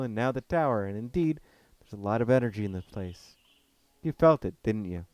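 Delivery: loud, steady German man; calm but firm forest guardian; Cornell's voice. Acted.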